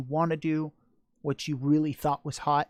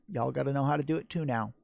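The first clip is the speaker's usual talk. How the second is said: ordinary delivery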